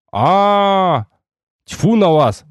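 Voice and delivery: high, drawn-out groan into a dismissal; mild exasperation; exasperated sigh. A narrator's reading. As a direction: give up on them with a sigh. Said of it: "вздохнул я".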